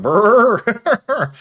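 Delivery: high, loud silly cow noise; cartoon cow bellow; mock cow noise. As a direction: make the cow's noise, goofy and high. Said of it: "It's the cow going".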